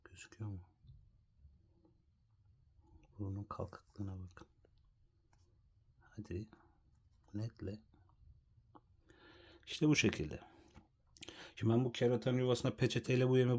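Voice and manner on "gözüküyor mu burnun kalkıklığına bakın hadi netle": soft, quiet filming aside; hushed. Real, not acted. Hushed while filming close.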